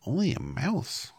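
high, loud, indignant little mouse; affronted small-animal voice; indignant cry. A narrator's reading. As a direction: squeak it out, offended. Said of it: "cried the little animal indignantly".

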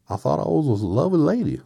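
high, loud character voice; Scarecrow. Acted. Straw man recalling Oz to friends, high loud voice. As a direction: pitch it way up, big and lilting. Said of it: "said the Scarecrow".

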